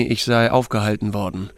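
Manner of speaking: plain reading voice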